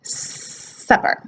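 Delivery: supper with a clean hissed S; sharp S in supper, tongue behind the teeth; correct S. Shown as a demonstration. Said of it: "but behind the teeth".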